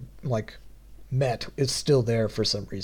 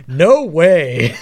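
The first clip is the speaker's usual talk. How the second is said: loud, higher mock Fred; Fred's flat no way; 4 semitones higher, 10 dB louder than usual. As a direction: blurt it out as Fred. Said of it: "And Fred goes".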